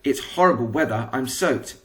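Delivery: ordinary delivery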